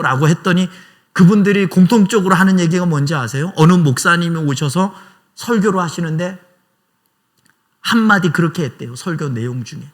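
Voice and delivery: normal talking voice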